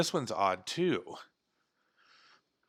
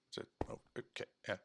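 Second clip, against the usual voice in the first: whisper; 3 semitones lower, 18 dB quieter, faster than usual. Real, not acted. Muttered under breath.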